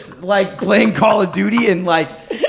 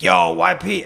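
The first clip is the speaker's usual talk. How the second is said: ordinary talk, nothing marked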